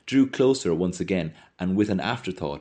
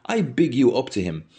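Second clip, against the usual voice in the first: higher-pitched sharp reproach; a touch of sharp indignation, pitch raised; 6 semitones higher, 4 dB louder than usual. A narrator's reading. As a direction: complain sharply to a friend. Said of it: "Mulligan said sharply to Stephen".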